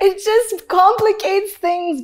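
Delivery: high, loud, drawn-out words through laughter; laughing. Real, not acted. Guest laughing at her own intensity, words through laughter. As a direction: talk slowly through a real laugh.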